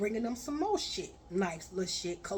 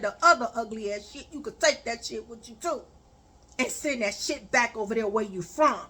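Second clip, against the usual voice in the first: louder and faster, scolding; angry rant; 5 dB louder than usual. Retold or imitated. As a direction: tear into him, raising your voice. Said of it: "And I said".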